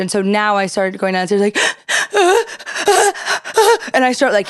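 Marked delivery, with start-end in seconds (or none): 1.6-3.9 high, hammed-up gasping; stage a gasp for air; fake gasp. Wife faking a panic attack for John, high theatrical gasp. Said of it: "I'm on stage".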